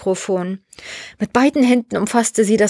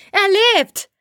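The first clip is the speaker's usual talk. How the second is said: high, jubilant cry with falling end; euphoric exclamation; 12 semitones higher than usual. A narrator's reading. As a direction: announce it joyfully to everyone. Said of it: "rief Vitali euphorisch".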